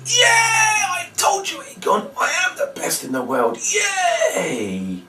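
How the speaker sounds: very high, hammed-up squeals of glee; squeaky mock bragging; gloating victory cry. Shown as a demonstration. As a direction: boast like the world's best magician. Fake magician gloating at kids.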